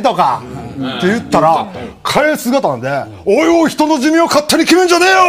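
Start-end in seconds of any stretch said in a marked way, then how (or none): 3.2-5.2 turtle's mock protest. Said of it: "声とかめっちゃおもろい".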